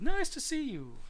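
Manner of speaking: high-pitched welcoming hoot; piping duckbill dinosaur. A narrator's reading. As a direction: hoot a friendly greeting. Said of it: "they hooted".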